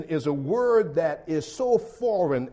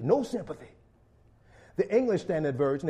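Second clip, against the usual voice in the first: ordinary talk, nothing marked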